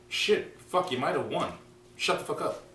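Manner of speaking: high-pitched fed-up outburst; mock exasperation. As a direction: give up the argument, voice jumping high. Acting out fed-up reply to partner.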